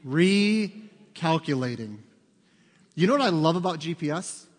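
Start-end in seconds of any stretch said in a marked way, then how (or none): 0.0-2.0 drawn-out sat-nav announcement; imitated GPS, very slow and measured. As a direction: stretch it out slowly like a navigation system. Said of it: "That little voice says what?".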